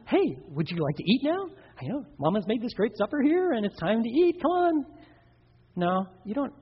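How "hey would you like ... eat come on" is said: pleading parent, pitch rising at the end; mock-sweet coaxing, high on the last words; coaxing question. Shown as a demonstration. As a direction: wheedle a child to the table. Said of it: "You don't ask them".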